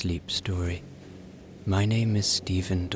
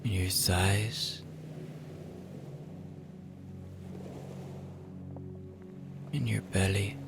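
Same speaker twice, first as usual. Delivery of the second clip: guided relaxation; 8 dB quieter than usual. Acted. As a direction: ease them toward sleep, unhurried.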